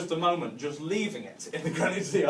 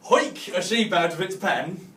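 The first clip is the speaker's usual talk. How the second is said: usual speaking voice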